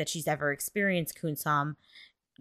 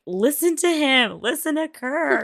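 higher, louder urgent appeal; pleading imitation; 7 semitones higher, 4 dB louder than usual. Mon begs Kun-sam to hear Kirk out, pleading. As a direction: lean on each plea, lightly hammed up.

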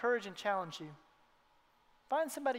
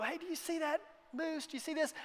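excited guide; 6 semitones higher, 5 dB quieter than usual. Retold or imitated. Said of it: "pull off the side of the road and go".

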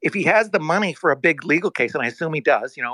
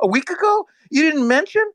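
higher pitched, incredulous question; shocked disbelief; 10 semitones higher than usual. Retold or imitated. Retelling his call with the CEO, higher and incredulous. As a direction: sound stunned that he never said it. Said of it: "in shock".

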